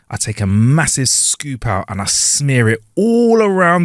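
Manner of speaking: drawn-out, deliberate line reading; slow, emphatic joke line; slow punchline delivery. Shown as a demonstration. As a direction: savour each word slowly. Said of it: "let me do the line like that".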